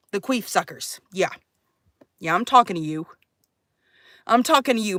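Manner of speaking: ordinary delivery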